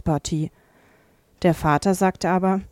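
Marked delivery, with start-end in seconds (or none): none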